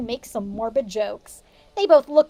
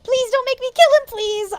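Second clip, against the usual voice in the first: much higher, pleading mock voice; Ebony begging the villain, hammed-up; 12 semitones higher, 4 dB louder than usual. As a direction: beg in a high squeaky voice. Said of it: "I begged".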